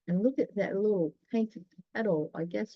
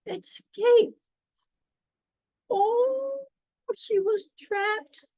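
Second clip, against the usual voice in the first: much higher, hammed-up cry of alarm; dramatic dismay; 4 dB louder, much slower than usual. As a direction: sound shocked for the kids. Dramatic peril in story.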